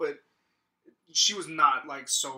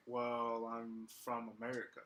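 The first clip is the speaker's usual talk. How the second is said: low-key manager; 5 semitones lower, 11 dB quieter than usual. Retold or imitated. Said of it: "And he was like".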